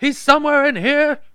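over-the-top high villain; Judge Doom. Retold or imitated. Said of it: "Judge Doom".

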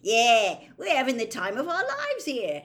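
loud, high mock enthusiasm; heavily ironic cheer; sarcastic. A narrator's reading. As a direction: pretend to be having great fun while squashed. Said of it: "whose voice sounded muffled".

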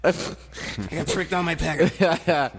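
exaggerated Boston accent. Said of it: "I like your Boston accent. That's over-exaggerated".

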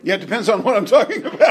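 high, loud, quick laughing agreement; words tumbling out into a big laugh. Real, not acted. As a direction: crack up while conceding the point. Laughing at listener's teasing answer.

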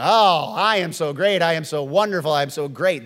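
lightly voiced bragging acquaintance; mock self-praise. Pastor imitating someone praising themselves, mock smug. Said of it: "They're having this conversation with me".

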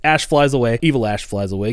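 usual speaking voice

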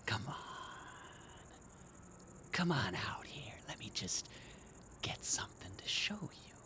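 low, menacing whisper; creepy near-whisper, stalking prey; hushed coaxing. Acted. Luring hidden pests out quietly.

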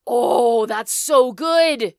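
loud, high, drawn-out rave; real delight. Real, not acted. Listener thrilled by co-host's dragon voice idea, delighted. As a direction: gush loudly, stretching it out.

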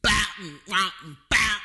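mouth slap bass. Shown as a demonstration. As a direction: slap the bass with your lips. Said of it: "like, slap bass".

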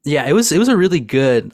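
usual speaking voice

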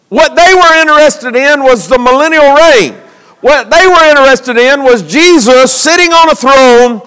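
emphatic sermon cadence with weighty pauses; preaching. Real, not acted. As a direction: proclaim it to the congregation.

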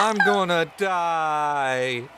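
high, slow sobbing wail; crying child. Acted. Terrified child sobbing to the player, wailing. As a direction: blubber it out like a scared kid. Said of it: "starts crying even harder and goes".

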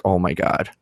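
a mother reads her daughter's text as annoyed; annoyed eye roll. Shown as a demonstration. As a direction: say it rolling your eyes. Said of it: "Or is she rolling her eyes like".

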